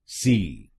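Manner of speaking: teacher drills सी; last syllable alone. Shown as a demonstration. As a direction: isolate the syllable सी. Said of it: "आखिरी शब्दांश को बोलिए सी".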